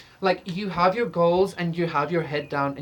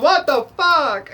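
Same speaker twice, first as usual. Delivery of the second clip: mock shocked; 10 semitones higher, 10 dB louder, slower than usual. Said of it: "You're like".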